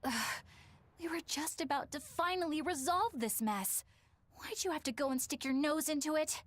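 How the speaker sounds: high-pitched, annoyed telling-off; exasperated scolding. Acted. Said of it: "Why are you yelling at me?".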